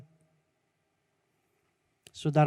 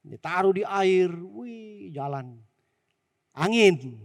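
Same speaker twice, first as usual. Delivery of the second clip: ordinary talk, nothing marked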